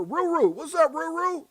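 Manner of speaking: high, loud, delighted greeting; hyping up a regular in the chat; excited shout-out. Real, not acted. Host greeting viewer Ruru with a high excited call.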